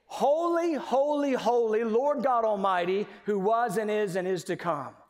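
high, loud cry of praise, settling toward the end; ringing scripture acclamation; worshipful proclamation. Acted. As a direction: proclaim it boldly, opening high on each holy.

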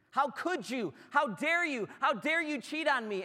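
hammed-up betrayed fiance; mock outrage. Joseph confronting his pregnant fiancee, indignant. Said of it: "Imagine what his response would be".

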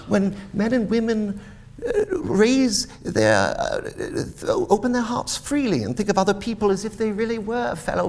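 high, lilting heartfelt speech; earnest nephew. Acted. Nephew defends Christmas to his uncle, earnest. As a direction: plead the case for Christmas in a high, eager voice.

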